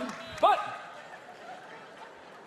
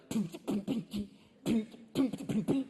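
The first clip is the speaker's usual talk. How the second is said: soft, faltering pum pum heartbeat; weak heartbeat mimic; 2 semitones higher, 4 dB quieter than usual. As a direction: imitate a failing heartbeat. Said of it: "my heartbeat was like".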